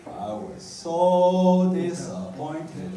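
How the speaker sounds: slow, drawn-out mock accent; God as the mother, slow and lightly accented; mock Chinese accent. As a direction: stretch out the disappointment like the mother. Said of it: "the Chinese accent".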